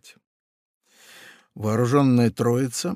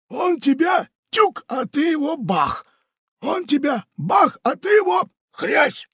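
blustering pirate. Acted. Pirate teaches Funtik brawling, very high and blustering. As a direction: bluster about brawling with gusto.

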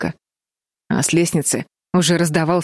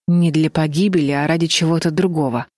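plain reading voice

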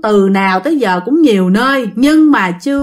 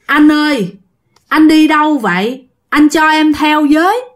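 a ghostly man's plea, pitched up and louder; giọng ma ghê rợn; 5 semitones higher, 3 dB louder than usual. Retold or imitated. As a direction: call out eerily to the passerby. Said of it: "anh nói rằng nghe ghê lắm". (giọng ma gọi ghê rợn, cao và to)

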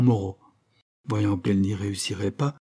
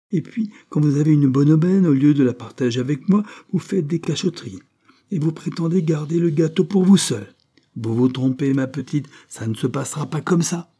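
high, loud indignant scolding; heated accusation, pitch kept high; angry reproach; 6 semitones higher, 4 dB louder than usual. A narrator's reading. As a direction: berate them with rising outrage. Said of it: "la colère la plus basse".

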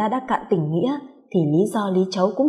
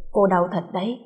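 ordinary delivery, nothing marked

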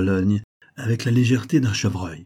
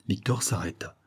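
ordinary delivery

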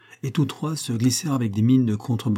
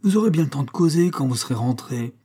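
scolding, higher-pitched complaint; grumbling; 6 semitones higher than usual. A narrator's reading. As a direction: chide the dawdlers impatiently. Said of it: "bougonnait Toinose". (reproche bougon, voix plus haute)

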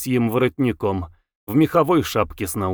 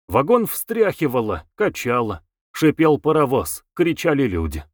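plain reading voice

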